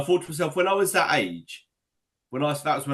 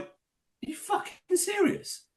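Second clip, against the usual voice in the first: high, slow, disbelieving retort; incredulous outburst; 3 dB quieter than usual. Retold or imitated. Retelling the teammate who took his penalty, incredulous and high. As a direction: stretch it out in outraged disbelief. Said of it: "And I went".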